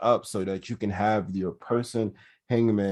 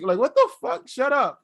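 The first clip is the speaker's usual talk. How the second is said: mocking voice; 11 semitones higher, 3 dB louder than usual.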